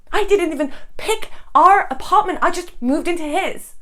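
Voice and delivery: aggrieved. Acted. Wife airing grievance about marriage.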